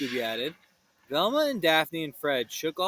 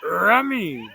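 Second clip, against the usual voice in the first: dog voice; 6 dB louder than usual. Acted. Scooby sniffing food.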